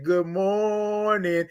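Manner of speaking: very slow, drawn-out "Good morning" with the r left off, "mornin'"; signature good morning. Shown as a demonstration. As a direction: stretch out "Good morning" long and lazy, no r sound. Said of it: "She put the R's all the way across".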